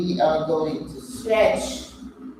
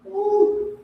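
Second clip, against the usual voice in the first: excited whoop. Real, not acted. Exercise whoop.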